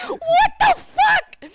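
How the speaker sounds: very high, loud, slow shriek; drawn-out squealed shock; mock alarm. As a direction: scream it in exaggerated disbelief. Acting out alarmed reaction.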